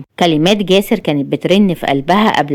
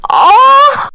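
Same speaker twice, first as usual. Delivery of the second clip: high, loud agonised cry; long high "aah" of agony; scream of pain; 6 dB louder, much slower than usual. A narrator's reading. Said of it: "صرخت بيها بألم".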